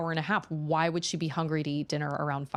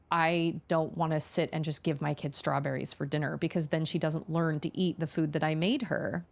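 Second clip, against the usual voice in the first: usual speaking voice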